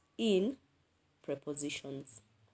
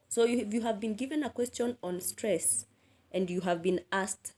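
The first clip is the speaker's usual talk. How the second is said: normal talking voice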